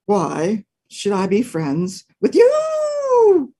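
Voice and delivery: slow, drawn-out wolf voice; a grudging wolf, slowly; slow suspicious wolf; gruff wolf. Acted. Wolf answers dog.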